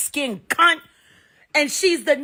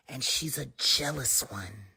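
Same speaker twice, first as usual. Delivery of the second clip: whisper; 13 semitones lower, 8 dB quieter than usual. Real, not acted. Gossiping aside.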